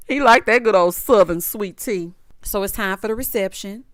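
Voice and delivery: usual speaking voice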